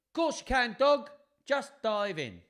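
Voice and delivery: put-on high, loud child's reply; welcoming children's voice. Children inviting dog into bath, high eager call.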